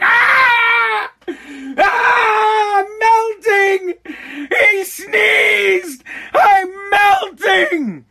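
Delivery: high, loud, mock-shrieking "I'm melting!"; melting witch panic. School staff panicking over a sneeze, hammed-up witch shriek. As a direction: scream like a melting witch, stretched out long. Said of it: "going into pandemic mode".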